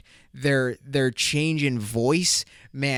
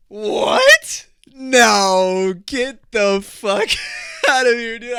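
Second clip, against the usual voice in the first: hammed-up outrage, much higher and louder; fake shocked disbelief; 8 semitones higher, 9 dB louder, much slower than usual. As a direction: play the stunned friend for an Academy Award. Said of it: "I said it just like that".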